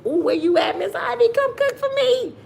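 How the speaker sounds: high, thrilled call to a chatter; excited calling out. Real, not acted. Calling to Miss Ivy in chat, high and excited. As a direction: sing out her name, delighted.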